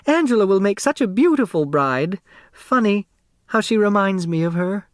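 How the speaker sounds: put-on a small, round seamstress selling her old shop; eager dressmaker. Dressmaker grinning through grief.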